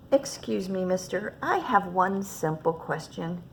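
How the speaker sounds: plain reading voice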